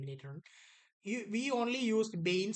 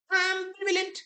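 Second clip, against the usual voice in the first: announced option; 12 semitones higher, 10 dB louder than usual. Shown as a demonstration. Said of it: "what is the author's attitude".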